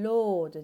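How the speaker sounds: 英語のLで「ロード」; English L demo. Shown as a demonstration. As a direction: model the word ロード clearly. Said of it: "これは負荷という意味があります".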